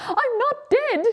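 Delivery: squealing, very high joy; delighted quack. Acted. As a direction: burst out thrilled, pitch soaring. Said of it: "she quacked, utterly delighted".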